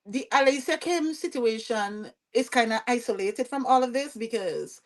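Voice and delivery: ordinary talk, nothing marked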